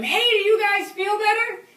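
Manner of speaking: high, drawn-out holler from way up; yelling down. Retold or imitated. Tree sitter calling down to the men who shot at her, loud yell. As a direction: call down loudly to people far below. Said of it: "I yelled down to them".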